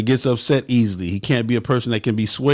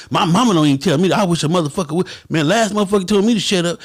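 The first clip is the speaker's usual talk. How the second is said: higher, petulant comeback; little boy attitude; 6 semitones higher, faster than usual. Retold or imitated. Member answers the president like a little boy: high petulant retort. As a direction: whine back like a sulky kid.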